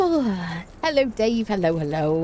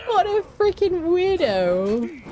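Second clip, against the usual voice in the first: slow, much higher scoff; scornful; 10 semitones higher, much slower than usual. Real, not acted. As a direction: drag out the disbelief. Scoffing at the troll.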